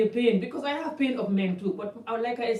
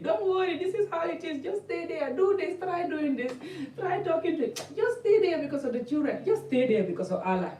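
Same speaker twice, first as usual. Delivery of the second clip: imitating an advice-giving friend in high pitch; high, hammed-up coaxing to put up with it; mock reassuring; 7 semitones higher than usual. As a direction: play the consoling auntie, voice pitched up. Acts women advising her to endure.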